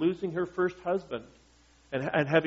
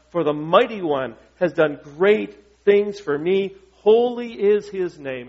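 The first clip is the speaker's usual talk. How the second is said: scripture reading; 5 dB louder than usual. Acted. Reads Mary's song.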